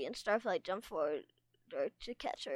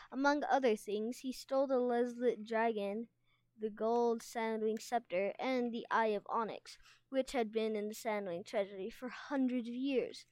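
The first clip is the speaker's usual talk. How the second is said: ordinary delivery, nothing marked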